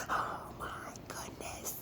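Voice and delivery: slow, hushed whisper; whispered mock horror; horrified realization.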